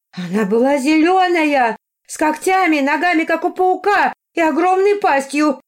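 very high, loud alarmed woman; worked-up, high-pitched eyewitness; agitated insistence. A narrator's reading. Frightened woman insists on the monster.

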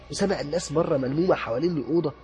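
usual speaking voice

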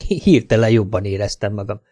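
plain reading voice

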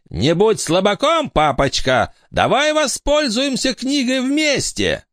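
very high taunting daughter; high girl voice. Acted. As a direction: sneer at papa in a high voice. Eleanor taunts her father.

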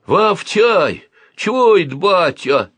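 high and loud calling out; excited boyish call. A narrator's reading. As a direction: shout across the street. Boy calling out on street.

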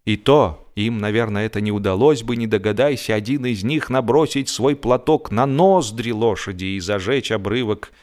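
plain reading voice

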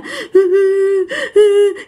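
high-pitched mock wailing; imitated sobbing. Mother crying uncontrollably, imitated high sobs. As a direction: sob in a high acted whimper. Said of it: "Mà lúc đó mẹ cứ nói nha".

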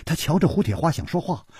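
usual speaking voice

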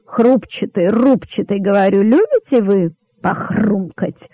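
relishing, drawn-out taunt; mercilessly booming. A narrator's reading. King tempting hungry rabbits, mercilessly pressing on. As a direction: stretch it out to torment them, mildly booming. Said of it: "безжалостно гремел король".

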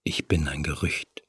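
tonlos geflüstert, ganz nah am Ohr. A narrator's reading. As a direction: hauch es ihr leise ins Ohr. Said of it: "Seine Stimme war zu einem Flüstern abgesunken". (Dämon flüstert ihr verführerisch ins Ohr, tonlos)

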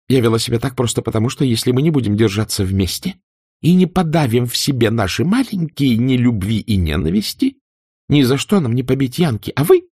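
high, fast, rising to a cry; furious tirade. A narrator's reading. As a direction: lash out and escalate.

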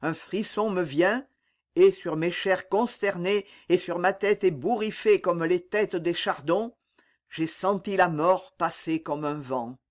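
ordinary delivery, nothing marked

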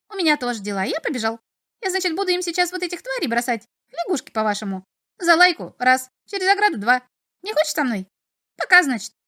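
high, chattering little imp. A narrator's reading. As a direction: rattle on like a cheeky imp. Imp says goodbye.

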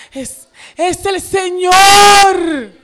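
slow, loud, high cry of recognition; joyful shout. Retold or imitated. Disciple telling Peter, slow overjoyed shout. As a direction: shout it out, stretched with joy. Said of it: "le dice a Pedro".

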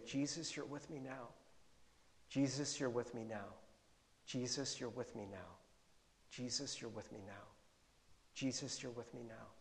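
very soft, low repeated prayer; calming prayer. Retold or imitated. Preacher re-enacts praying through his worry, hushed and calming. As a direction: hush it down, pausing between each repetition.

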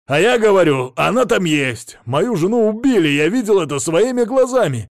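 loud, high, insistent tirade; yelling. A narrator's reading. Husband insisting his wife was killed, yelling. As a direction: keep shouting to be believed. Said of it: "заорал Мамонов".

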